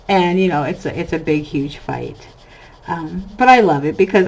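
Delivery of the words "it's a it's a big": ordinary talk, nothing marked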